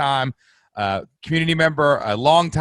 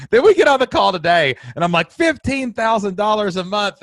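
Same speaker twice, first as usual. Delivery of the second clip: excited; 9 semitones higher, 6 dB louder than usual. Real, not acted. Excited retelling of member milestone.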